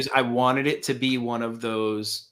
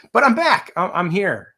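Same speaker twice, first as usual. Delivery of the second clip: triumphant; 6 semitones higher, 5 dB louder than usual. Real, not acted. Triumphant return announcement.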